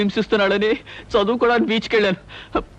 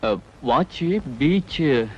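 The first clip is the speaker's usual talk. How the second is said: low, very slow, halting reply; reluctant, low-pitched faltering; hesitant answer; 6 semitones lower, 3 dB quieter, much slower than usual. Acted. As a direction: drag the words out nervously. Nervous answer to brother's questioning.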